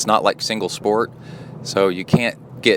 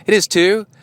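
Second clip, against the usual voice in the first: mock indignant protest; 13 semitones higher, 5 dB louder than usual. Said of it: "And she said".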